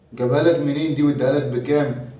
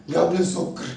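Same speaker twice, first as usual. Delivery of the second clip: ordinary talk, nothing marked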